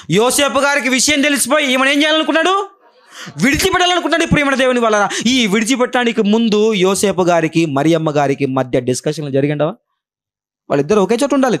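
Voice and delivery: high, driving sermon delivery; preaching. Acted. Preacher pressing the church about Joseph, high and driving. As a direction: push each question at the church.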